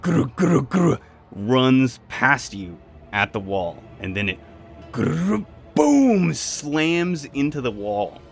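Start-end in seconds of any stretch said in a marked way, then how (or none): 0.0-1.0 imitated charging noise. Narrating the creature charging past. 4.9-6.3 high, loud "gru, boom" smash sound; vocal crash effect. Acted. Narrator voicing the creature slamming into the wall, a loud boom.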